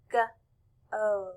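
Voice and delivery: g-o split into "guh" and "oh" with a pause; sounded out. Shown as a demonstration. Teacher showing class the sounds in a word, sounded out. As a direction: say "g-o" as two separate sounds. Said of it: "If we were to blend the word go".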